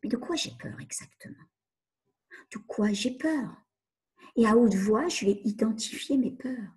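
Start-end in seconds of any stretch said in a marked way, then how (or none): 0.0-3.6 soft whisper, asking herself; hushed, turning the question inward. Shown as a demonstration. Coach asks herself what she fears, whispered. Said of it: "je vais me poser la question".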